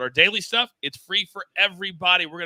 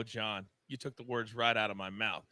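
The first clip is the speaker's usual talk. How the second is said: ordinary delivery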